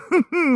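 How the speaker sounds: quick high-pitched giggle. Real, not acted. Laughing at his own joke to viewers, high giggle. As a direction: burst into a giggle.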